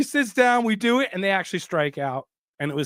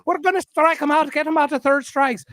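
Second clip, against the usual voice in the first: put-on high-pitched stage Irish accent, rushed at the start; hammed-up leprechaun Irish accent, pitched up high; 9 semitones higher, 4 dB louder than usual. Irish fan urging the crowd at the strikeout, in a high leprechaun Irish accent. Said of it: "he's not Leprechaun Irish".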